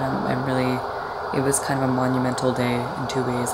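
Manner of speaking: usual speaking voice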